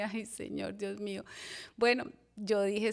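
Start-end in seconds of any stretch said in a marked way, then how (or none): 0.0-1.2 much softer, a worried plea under the breath; hushed anxious prayer. Retold or imitated. Softly praying to God while her mother objects. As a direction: murmur it like a nervous prayer.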